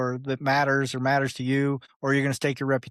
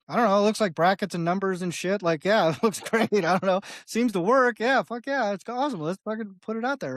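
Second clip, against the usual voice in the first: higher-pitched mock clueless approval; naive enthusiasm; 6 semitones higher than usual. Non-coder signing off on code he can't read, naive enthusiasm. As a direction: get carried away, as a clueless app maker would.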